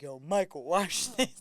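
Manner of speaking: low, mock-cool call to a buddy; show-off boy. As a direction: imitate a boy showing his friend a stunt. Acts out altar boy showing off.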